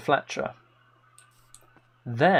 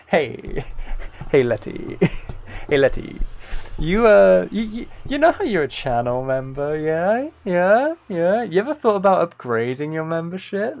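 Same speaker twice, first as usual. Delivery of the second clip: sales pitch; 4 semitones higher than usual. Pretend. Teasing viewer with membership upsell.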